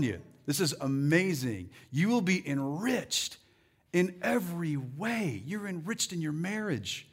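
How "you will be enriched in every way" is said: normal talking voice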